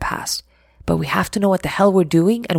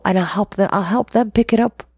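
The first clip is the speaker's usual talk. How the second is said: ordinary delivery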